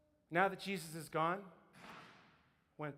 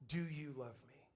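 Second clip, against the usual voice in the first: low, hushed, intimate voice; quiet and searching; tender questioning; 5 semitones lower, 10 dB quieter than usual. Acted. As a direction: ask softly, going deep. Jesus's question that goes deep.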